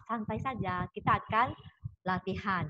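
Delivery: ordinary talk, nothing marked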